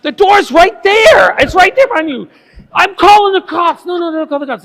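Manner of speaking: high loud shouting at the intruder; panicked high shout, voice near cracking. Retold or imitated. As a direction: yell the way out at him, frantic. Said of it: "I'm like".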